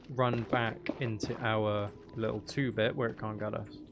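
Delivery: normal talking voice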